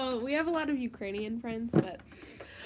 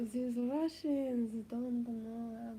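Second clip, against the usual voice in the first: mock Russian accent; 5 dB quieter, slower than usual. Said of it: "Russian is like".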